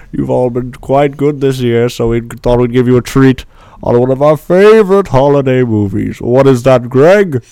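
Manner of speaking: jolly Santa talking; lightly voiced Santa host. Still in Santa voice.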